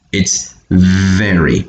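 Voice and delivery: "very" with a long, buzzed V; slow down and lean on the V in "very"; correct V sound. Shown as a demonstration. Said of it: "problem making this sound over here".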